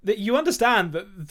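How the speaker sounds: ordinary delivery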